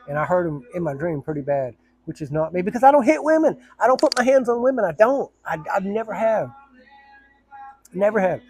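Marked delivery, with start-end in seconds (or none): 2.6-8.4 higher and louder protest; real emphatic denial; insistent. Real, not acted. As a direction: defend yourself firmly. Insists he never hits women.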